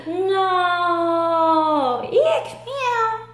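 high, shouted panic at the cat; frightened shriek. Acted. Simon meeting the cat, high frightened shriek. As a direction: shriek and leap back in fright.

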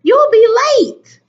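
high, loud warning call. A narrator's reading. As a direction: holler it to a dawdling child. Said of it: "his mother called".

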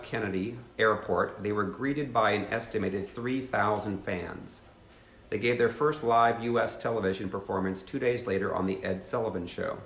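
ordinary delivery

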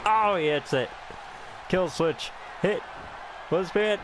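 real thrilled outburst, building to the cry; excited yell. Real, not acted. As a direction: yell it out as the move connects. Finisher lands, wins the title.

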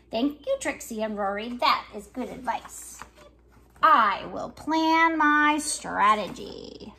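lively picture-book reading with voices for each character; reading to children. Acted. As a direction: read it like story time for little kids. Reads picture book aloud.

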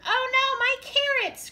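high, loud wail; crying out in dismay. A narrator's reading. Rabbit losing his carrots in the collapse, crying out high. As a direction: cry out in high-pitched alarm. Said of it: "cried Rabbit".

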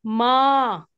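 मा with the vowel held for two counts; long maa. Shown as a demonstration. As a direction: stretch मा out to a long aa, two beats. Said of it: "टू count". (मा को दो count तक खींचकर)

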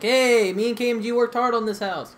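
loud, high retold protest; indignant yell. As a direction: shout it like a wronged homeowner. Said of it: "I'm like".

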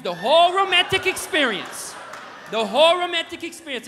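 high, loud, big build on "whole"; hammed-up emphasis. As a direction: belt it out with comic grandeur. Exasperated dad's exhaustive explanation, hammed up.

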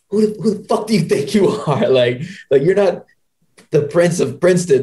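high, scornful coach voice; mock the coach putting him in his place; hammed-up high coach telling off a cocky freshman; imitated angry coach. Acting coach scolding cocky freshman.

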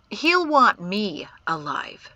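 slow, cool assurance. A narrator's reading. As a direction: say it coolly, taking your time. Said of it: "Jatesy said coolly".

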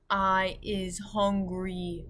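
slow, drawn-out giant voice; very slow storybook giant; booming giant. A narrator's reading. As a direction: stretch each word out as a big hungry giant. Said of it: "the giant boomed".

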